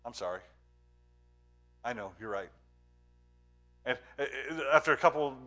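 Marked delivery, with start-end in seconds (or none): 0.0-2.5 quietly conceding, a touch softer; teacher calmly agreeing with the bullying kid; soft apology. Retold or imitated. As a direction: give in gently, no fight. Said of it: "and he goes".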